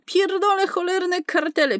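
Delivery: high, angry swearing; angry outburst. Acted. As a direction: spit out the curse in fury. Furious outburst about cartels. (wściekłe przekleństwo, wysoko)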